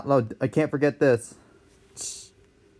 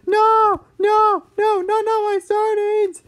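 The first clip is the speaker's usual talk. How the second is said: put-on squeaky, louder old man wail; high whiny dad protest; 4 dB louder, slower than usual. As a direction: cry out for the sardines. Dad losing sardines.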